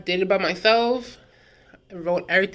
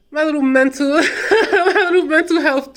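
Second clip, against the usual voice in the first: laughing through the words, higher and louder; real laughter breaking in; 8 semitones higher, 6 dB louder than usual. Real, not acted. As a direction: giggle the whole line out. Laughs at own throwback story.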